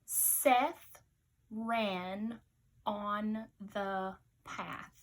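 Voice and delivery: each word slow and separate; slow dictation pace. Shown as a demonstration. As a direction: read it out slowly for dictation. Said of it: "your sentence is".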